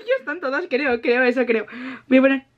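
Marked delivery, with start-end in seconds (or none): 0.0-0.7 laughing through the words. Real, not acted. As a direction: say it again while laughing. Repeat with laughter.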